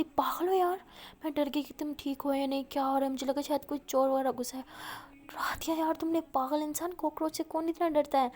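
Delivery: exasperated scolding. Acted. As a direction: tell her off in relief. Scolding after scare.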